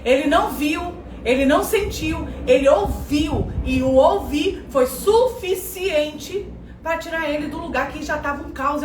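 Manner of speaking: usual speaking voice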